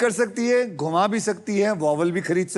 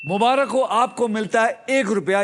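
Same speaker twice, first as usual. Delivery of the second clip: ordinary delivery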